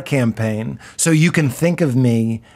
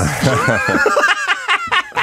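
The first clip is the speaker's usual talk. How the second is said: burst of laughter. Real, not acted. Laughing at his own joke.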